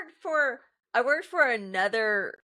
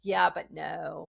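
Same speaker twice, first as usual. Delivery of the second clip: dismissive; 6 semitones lower, slower than usual. Retold or imitated. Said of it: "you guys go".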